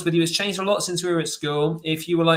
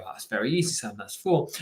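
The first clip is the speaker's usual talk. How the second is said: lower, softer know-it-all dad voice; smug parent; 6 semitones lower, 4 dB quieter than usual. Retold or imitated. Parent answers child's easy sum, lower smug dad voice. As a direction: answer like a proud, unbothered father. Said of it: "And you go".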